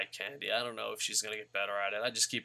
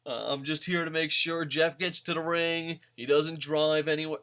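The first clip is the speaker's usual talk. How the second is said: chirpy, louder, higher mock chauffeur voice; mock Matt Hardy; 4 semitones higher, 5 dB louder than usual. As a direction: play the cheerful designated driver, higher and louder. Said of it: "He's like".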